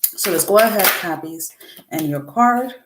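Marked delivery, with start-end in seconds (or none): none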